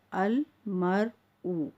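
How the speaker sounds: very slow "अल मर ऊ", each part held; separated syllables. Shown as a demonstration. Teacher giving the assembled word to learners, syllable by syllable. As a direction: draw out "अल", "मर" and "ऊ" one by one. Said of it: "बिल्कुल अलग-अलग करके पढ़ रही हूँ".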